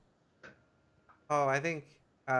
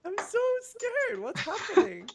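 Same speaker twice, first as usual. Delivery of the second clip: high, slow, squeaky mock terror; mock fear; slower than usual. Streamer faking fright at the marriage talk, high and squeaky. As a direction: play scared in a tiny voice.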